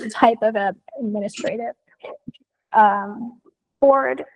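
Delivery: hoarse voice fading to whisper; voice giving out. Real, not acted. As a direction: voice drops to a breathy whisper after cough. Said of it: "my voice is gone".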